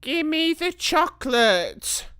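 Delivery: put-on high, loud childish demand; boy's shout. A narrator's reading. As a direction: Bruno shouting for his prize. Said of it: "shouted Bruno".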